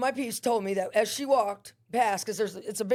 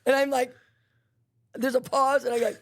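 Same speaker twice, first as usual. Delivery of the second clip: ordinary talk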